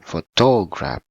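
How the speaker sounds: "photograph" wrongly stressed on a long, loud "TO"; misplaced middle stress. Shown as a demonstration. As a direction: drag out and punch the middle "to" of "photograph".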